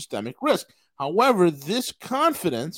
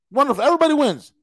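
sarcastic; 8 semitones higher, 6 dB louder, much faster than usual. Real, not acted. Mocking the power grab.

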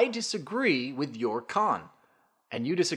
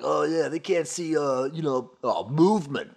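mock scientist; 4 semitones higher than usual. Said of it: "because that's how scientists sound".

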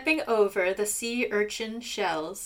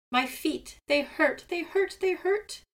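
rising higher cry of pain; 7 semitones higher than usual. A narrator's reading. Seagull crying as Raven digs again, climbing pained wail. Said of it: "Seagull screamed again".

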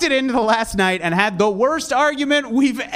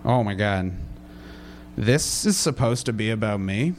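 mock stunned mutter; 12 semitones lower, 6 dB quieter than usual. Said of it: "And I was like".

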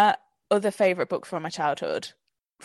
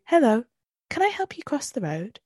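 higher, put-on friendly grandmother; lightly voiced kindly witch on a tape; sweet old lady voice; 6 semitones higher than usual. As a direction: play the kindly stranger, a touch higher. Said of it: "they obviously sound identical".

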